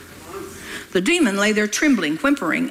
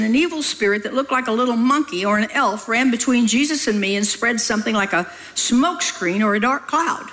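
ordinary talk, nothing marked

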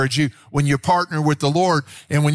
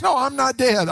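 retold protest; 12 semitones higher than usual. Retells son answering shocked mother.

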